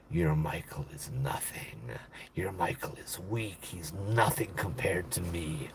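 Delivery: low, soft, menacing demonic voice; a demon sneering through the girl, low and soft; possessed demon voice. Retold or imitated. Possessed girl, eyes rolled back.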